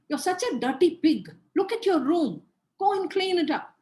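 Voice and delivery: put-on loud, scornful scolding of a child; scolding parent. Shown as a demonstration. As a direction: bark it at the messy kid. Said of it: "Don't say".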